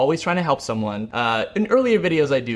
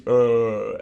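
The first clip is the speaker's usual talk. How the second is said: low, dopey cartoon "uhh"; drawn-out dim cartoon groan; Patrick; 3 semitones lower than usual. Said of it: "then you have Patrick".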